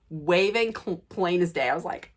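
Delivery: usual speaking voice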